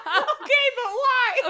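high, loud squeal through laughter; laughing mock protest. Mimicking the dad questioning his wife, laughing high protest. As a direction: crack up while demanding a reason.

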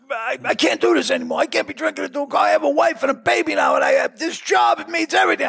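friend's panicked plea, hammed up; pleading friend. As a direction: imitate a rattled friend begging to quit. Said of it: "he goes".

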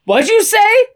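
high, sharp mock challenge; challenging dad. John confronting his muttering son, high acted challenge. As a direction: demand it with a rising pitch.

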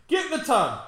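mock command. Mock voice bossing, playful.

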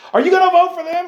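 high, loud pestering canvasser; pushy campaigner. Retold or imitated. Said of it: "They're like".

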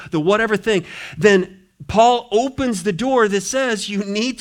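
fervent preaching; full-force preaching. Acted. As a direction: drive it home from the pulpit.

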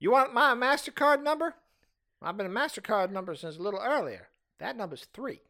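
put-on high, creaky old lady; a tottering old lady, chuckling. Continues Mabel old lady bit.

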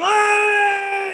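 long, high, loud imitated yell; imitated scream. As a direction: re-enact a drawn-out bar-room scream. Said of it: "And I'm screaming".

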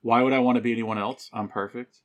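normal talking voice

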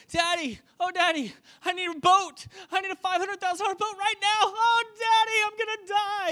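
high-pitched, hammed-up panicked son; whining child. Drowning son demanding a boat from Daddy, whining. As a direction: wail for Daddy in mock desperation. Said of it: "he's whining, he's complaining".

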